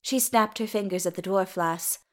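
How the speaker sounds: usual speaking voice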